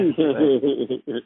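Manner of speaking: scratching sound. Retold or imitated. Imitates Qbert starting to scratch.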